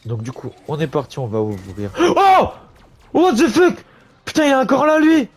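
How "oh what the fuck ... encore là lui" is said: high, loud startled outburst. Real, not acted. Streamer surprised by a zombie behind him, loud and high. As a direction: caught off guard, loud.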